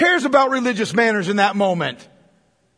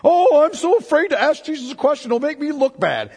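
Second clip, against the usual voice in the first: mock timid whine; 6 semitones higher, 4 dB louder than usual. Mocking the disciples' timid thoughts.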